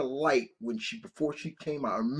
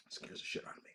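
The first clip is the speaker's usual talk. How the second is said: hushed whisper; 18 dB quieter, much faster than usual. Real, not acted. Admitting Halloween still scares him, whispered. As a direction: whisper it like a secret. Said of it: "whispered confession".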